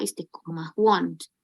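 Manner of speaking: usual speaking voice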